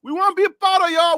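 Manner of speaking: light hammed-up Liberian English pleading; Liberian accent. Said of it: "Let me put in Liberian".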